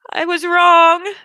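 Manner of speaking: put-on a child on the edge of crying; tearful child. Boy about to burst into tears.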